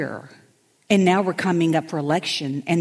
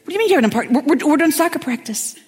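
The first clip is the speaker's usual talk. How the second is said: much higher, louder run of no's; higher flustered objection; mock alarmed protest; 8 semitones higher, 4 dB louder, faster than usual. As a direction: shoot it down in a high alarmed rush. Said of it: "And I'm like".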